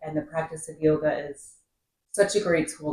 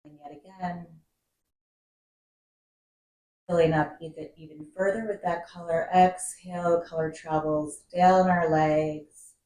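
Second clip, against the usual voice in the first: guided meditation. Acted. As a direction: guide the breath calmly, drawing out key words and letting phrases settle. Leads a seated breathing meditation.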